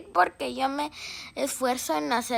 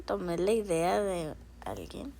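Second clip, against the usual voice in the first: whisper; 8 semitones lower, 8 dB quieter than usual. Real, not acted. Whispered confession aside.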